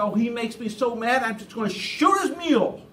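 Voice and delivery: hammed-up angry declaration; feigned fury. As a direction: act fed up to the limit, as a put-on. Pretended fury before shooting the mule.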